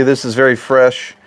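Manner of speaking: ordinary delivery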